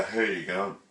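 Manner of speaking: mock greeting with a tensed face; unnatural talk. Shown as a demonstration. Teacher joking about how nobody talks, unnatural greeting. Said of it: "We don't talk like that".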